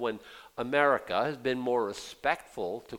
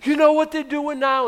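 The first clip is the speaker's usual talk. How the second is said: high, loud alarmed mom; mock alarm; 13 semitones higher, 9 dB louder than usual. Preacher imitates his mom, alarmed by C-SPAN, high loud panic. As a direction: sound like mom panicking at the news.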